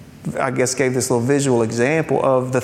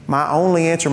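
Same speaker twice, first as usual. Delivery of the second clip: ordinary talk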